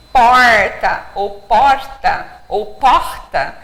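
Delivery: "porta" said three ways, each with a different regional R; three regional accents. Shown as a demonstration. Teacher demonstrating regional R sounds in "porta". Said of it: "são três sotaques diferentes".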